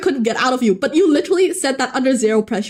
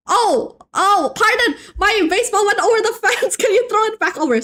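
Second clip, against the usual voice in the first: a touch higher, quicker polite ask; polite neighbour; 6 semitones higher than usual. Pretend. Streamer voices a neighbour asking for the ball, lightly acted. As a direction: play the kid asking for his ball back. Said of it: "it got to pardon my French".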